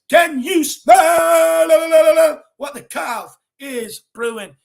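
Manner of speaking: wrestling promo shout, stretched out; shouted catchphrase. Acted. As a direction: belt it like a ring announcer. Reading a viewer's catchphrase aloud.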